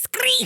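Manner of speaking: very high, full-voiced screech; favorite screech noise. Shown as a demonstration. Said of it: "that's my favorite noise".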